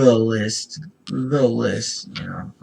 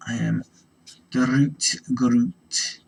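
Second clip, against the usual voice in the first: slow, odd creature voice; plant creature voice; 3 semitones higher, slower than usual. Pretend. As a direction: speak it like a lumbering tree being. Plays the plant character.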